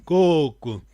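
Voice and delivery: Coco with the first O held long; long first vowel. Shown as a demonstration. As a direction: stretch the first syllable of Coco. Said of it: "Aquele coco é vogal longa, a primeira sílaba".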